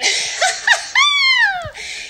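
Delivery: very high, long cackle; whooping laugh. Acted. Delighted new Texan, high whooping laugh. Crows about becoming a Texan.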